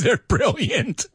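laughing praise. Real, not acted.

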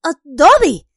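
high, loud frantic cry; desperate and urgent. Acted. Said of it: "le dijo Harry frenético".

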